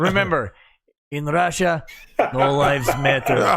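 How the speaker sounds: deadpan Russian villain voice; Russian accent. Pretend. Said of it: "Your Russian accent is off".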